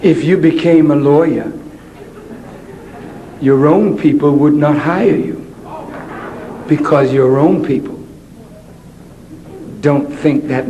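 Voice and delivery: low, patronizing teacher voice; condescending teacher. Retold or imitated. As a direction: talk down sweetly to the child.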